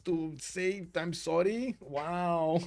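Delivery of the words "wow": normal talking voice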